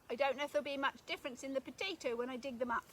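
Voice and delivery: ordinary talk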